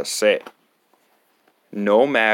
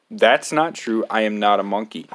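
plain reading voice

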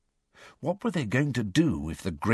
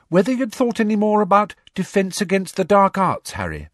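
put-on higher, brisk girl's voice; a clever schoolgirl pressing her friend; Hermione's voice; 13 semitones higher, 6 dB louder than usual. A narrator's reading. Hermione pressing Harry about lessons, in a higher girl's voice.

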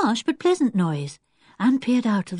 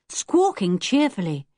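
plain reading voice

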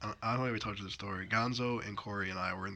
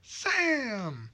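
annoyed yell; 12 semitones higher, 4 dB louder than usual. Retold or imitated. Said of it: "she'll just hear me go".

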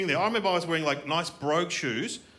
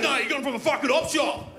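high, aggressive mock bogan voice; jeering bogan; 9 semitones higher, 3 dB louder than usual. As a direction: sneer an accusation at a kid. Acting the bogan jeering at him.

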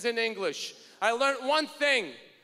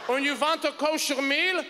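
ordinary talk

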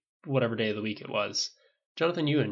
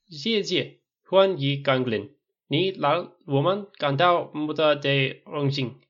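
slow, deliberate Mandarin, slightly raised; careful; 4 semitones higher, 6 dB louder, slower than usual. A narrator's reading. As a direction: pick through each foreign syllable with care. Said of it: "then said carefully".

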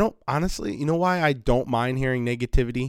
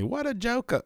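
higher but soft, quick quoted line; lighter echo of the clip; 13 semitones higher, 3 dB quieter than usual. Retold or imitated. Retelling the clip to listeners, a lighter imitation. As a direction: quote it again more lightly. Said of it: "did that funny".

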